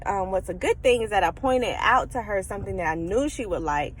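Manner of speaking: usual speaking voice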